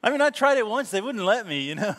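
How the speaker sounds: high, loud joking claim; playful fake admission; joking mock confession.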